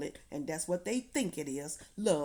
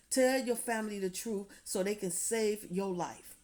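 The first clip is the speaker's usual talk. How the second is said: usual speaking voice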